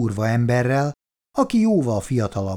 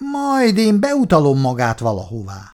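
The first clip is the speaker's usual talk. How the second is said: higher, louder angry comeback; snapped retort; 5 semitones higher, 5 dB louder than usual. A narrator's reading. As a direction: fire back a threat. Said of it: "vágta rá Bertók". (ingerülten rávágva)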